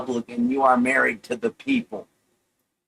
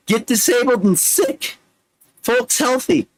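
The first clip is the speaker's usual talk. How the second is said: much higher, performed reading; high recitation voice; 8 semitones higher than usual. Acted. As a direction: recite it up high with a rising lift. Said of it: "read it like I'm the Micro Machine Man".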